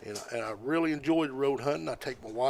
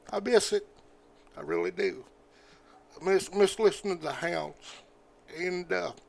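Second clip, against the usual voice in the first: real emotion, pitched up, halting with pauses; getting emotional; 5 semitones higher than usual. Real, not acted. Hunter admits he misses listening to the hounds, getting emotional. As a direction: choke up a little while trying to carry on. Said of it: "sorry, I'm getting emotional".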